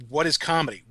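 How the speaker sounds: ordinary delivery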